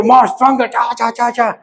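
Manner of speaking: high, rapid excited goading; egging on. Pretend. Teacher daring a volunteer to punch harder, excited goading. Taunting volunteer from safe distance.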